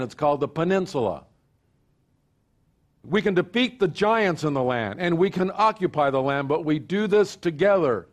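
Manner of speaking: building pastor's call to unity; preaching. Acted. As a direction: preach it out to the congregation. Preaching at full force.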